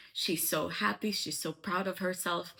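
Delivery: ordinary talk, nothing marked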